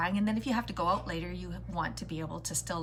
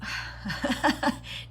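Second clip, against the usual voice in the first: bright laugh; 6 semitones higher, 3 dB louder than usual. Real, not acted. Laughs at opening.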